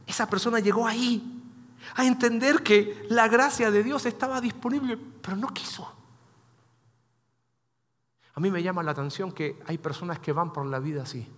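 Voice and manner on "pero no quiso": soft whisper, letting it drop; whispered regret. Real, not acted. Pastor telling the congregation the person refused, whispered regret. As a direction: breathe it out as a sad aside. Sad end of the story.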